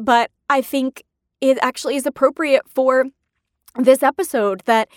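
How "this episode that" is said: usual speaking voice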